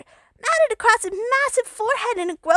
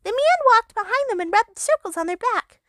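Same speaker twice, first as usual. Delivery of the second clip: usual speaking voice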